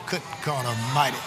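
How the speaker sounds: usual speaking voice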